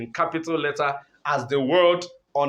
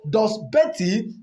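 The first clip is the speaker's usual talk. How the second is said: ordinary talk, nothing marked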